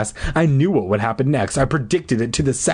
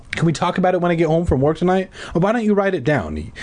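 hurried dad; 8 semitones higher, faster than usual. A narrator's reading. Dad brushing off his son.

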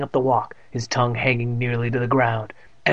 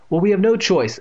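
lightly voiced mother, higher and louder; a hint of an exasperated mother; mother's voice; 7 semitones higher, 5 dB louder than usual. A narrator's reading. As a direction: pitch it up for the insisting mother. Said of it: "Evan heard his mother said".

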